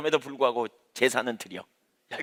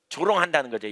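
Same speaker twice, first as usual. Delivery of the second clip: ordinary talk, nothing marked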